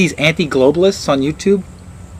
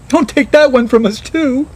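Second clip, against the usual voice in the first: mock pleading; 12 semitones higher than usual. Mocking theorists losing the moon landing, a high whiny plea. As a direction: beg like a whiny believer.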